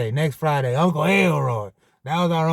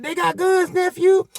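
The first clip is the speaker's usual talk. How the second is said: high frantic "get down, man"; panicked uncle; 12 semitones higher than usual. Retold or imitated. Uncle Elroy on all fours warning the nephews, high and panicked. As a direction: cry out like a scared uncle crawling.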